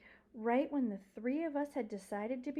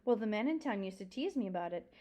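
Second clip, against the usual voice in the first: usual speaking voice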